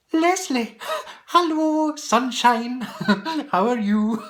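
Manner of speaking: high, loud, delighted hello; a squeal of welcome; excited greeting. Real, not acted. Greeting a viewer by name.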